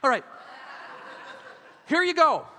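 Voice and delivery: ordinary talk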